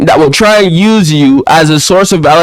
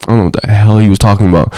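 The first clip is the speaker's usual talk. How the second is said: ordinary delivery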